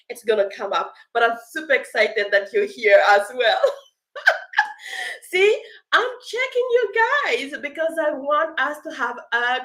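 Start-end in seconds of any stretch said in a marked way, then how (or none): 4.1-5.3 very high falling laugh. Real, not acted. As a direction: burst into a giggle. Happy laugh greeting Dax.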